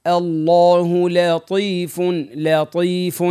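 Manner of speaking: الله with full heavy lam, slow and drawn out; slow teacher demo of الله لطيف; emphatic الله. Shown as a demonstration. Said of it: "نفخموها".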